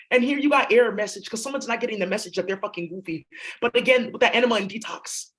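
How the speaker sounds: ordinary talk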